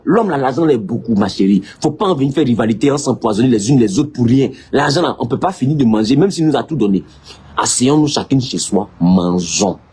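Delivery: low, calm patriarch voice; Solomon calming his wives. Retold or imitated.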